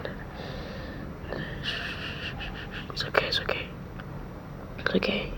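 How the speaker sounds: hushed, gentle whisper; calm them down softly; soothing whisper. Acted. To a crying friend, a soothing whisper.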